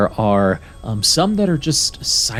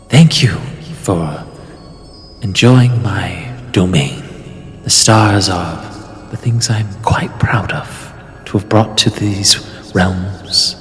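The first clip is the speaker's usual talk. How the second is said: loud, echoing goddess voice; unearthly voice; 5 dB louder than usual. Acted. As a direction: boom it out like a vast sky goddess. Star goddess speaks from the sky.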